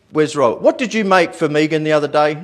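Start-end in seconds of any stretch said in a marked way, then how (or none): none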